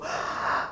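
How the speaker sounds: long breathy blow; blowing sound. Acted. Teacher acts out the wolf blowing the house. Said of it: "what does he do?".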